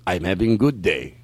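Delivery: low, slow impression of a man; imitated acquaintance's voice. Man answers the customer's greeting, low slow imitated voice. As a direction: drop low and slow, imitating his speech. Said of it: "do him doing that voice".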